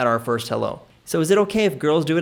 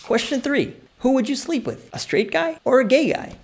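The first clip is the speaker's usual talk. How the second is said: much higher-pitched quiz question; quizmaster; 10 semitones higher than usual. Acted. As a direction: pose it high, like a mock game-show host. Mock rapid-fire quiz question.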